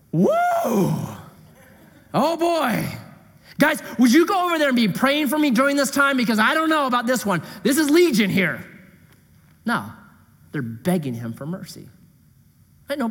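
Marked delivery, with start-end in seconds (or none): 0.1-8.6 mock nervous Jesus. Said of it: "What does Jesus say?".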